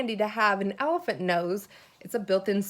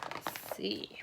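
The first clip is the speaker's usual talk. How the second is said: breathy whisper, much softer; teacher quietly peeking at the next page, whispered; whispered aside; 14 dB quieter than usual. Real, not acted. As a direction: mutter it to yourself under your breath. Murmurs while turning page.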